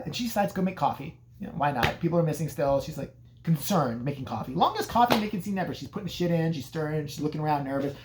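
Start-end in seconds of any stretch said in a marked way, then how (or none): none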